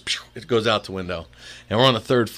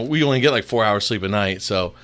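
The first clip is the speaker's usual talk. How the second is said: ordinary talk, nothing marked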